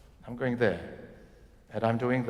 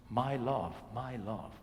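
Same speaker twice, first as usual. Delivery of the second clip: softer and softer "my love"; tender; 2 semitones lower, 8 dB quieter than usual. Shown as a demonstration. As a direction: say it to a lover. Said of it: "think really of something".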